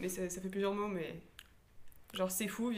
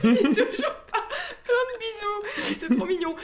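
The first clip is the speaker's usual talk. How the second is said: high squealing laughter through words; laughing hard; 11 semitones higher, 9 dB louder than usual. Real, not acted. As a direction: laugh helplessly while talking. Cracking up at the message.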